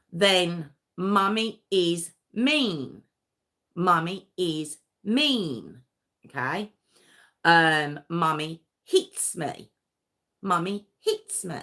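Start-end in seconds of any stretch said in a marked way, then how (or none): 1.0-5.8 slow, deliberate chanting; father dictating lines for the boys; drilled repetition. Retold or imitated. Drilling children to repeat. 8.1-11.6 drilled repetition. Retold or imitated. Drilling children to repeat.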